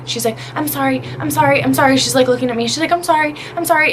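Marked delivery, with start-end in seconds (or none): 0.5-2.0 higher-pitched imitation of anxious apologies; repeated apologizing. Nail lady apologizing during a salon argument, imitated pleading. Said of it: "she keeps apologizing". 2.9-3.9 quick, high imitation of apologies; repeated apologizing. Nail lady apologizing during a salon argument, imitated hurried pleading. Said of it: "she keeps apologizing".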